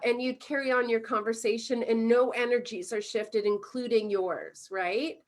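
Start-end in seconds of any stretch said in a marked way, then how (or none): none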